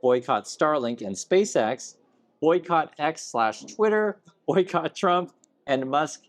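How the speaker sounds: slow, deliberate reading aloud; comment read-aloud. Acted. Host reading a viewer's comment to co-host, slow and deliberate. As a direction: read it out slowly, item by item.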